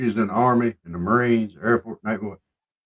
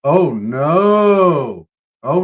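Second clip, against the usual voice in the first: usual speaking voice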